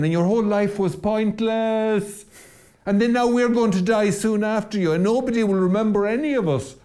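high, bright mock comforting voice saying awful things; cheery deathbed comfort, twisted into horror; mock cheerful monster. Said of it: "what sort of a monster says stuff like that".

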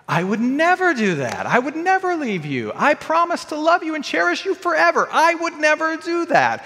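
high-pitched, effusive protest of devotion; emphatic reassurance. Acted. Husband protesting his devotion to his wife, emphatic reassurance.

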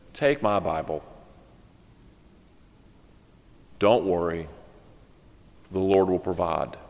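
low, soft, long-paused reassurance; comforting. Acted. Senior minister lends Bible to young preacher, calm comfort. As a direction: soothe quietly, leaving space between phrases. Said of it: "Comforting words".

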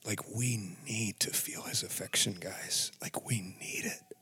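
hushed, intimate urging; whisper. Real, not acted. Telling his viewers they need God's love, whispered. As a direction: lean in and whisper the truth.

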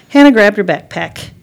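plain reading voice

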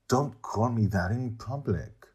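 ordinary delivery

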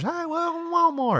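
very high, chirpy greeter welcome; door greeter voice. Retold or imitated. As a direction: squeak it like a cheerful greeter. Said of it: "They used to have door greeters".

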